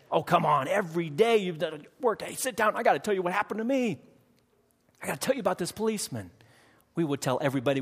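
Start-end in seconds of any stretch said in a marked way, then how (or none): 0.1-6.3 high, bursting with news, faster; excited telling. Pretend. Churchgoer grabbing coworkers to tell about the cop, excited and high. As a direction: grab a friend and blurt out the story.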